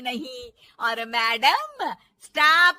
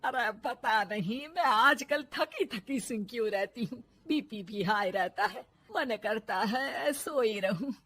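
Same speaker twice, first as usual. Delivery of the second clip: soft, weary moaning; tired complaint; 2 semitones lower, 8 dB quieter than usual. Acted. Worn-out mother-in-law complains of tiredness, soft and weary. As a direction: sigh it out exhausted.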